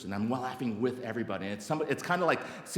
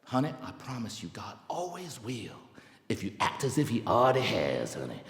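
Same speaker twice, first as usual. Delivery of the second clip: tender reassurance; 2 semitones lower than usual. Retold or imitated. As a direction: reassure gently, drawing out the words. Friend's tender promise, retold.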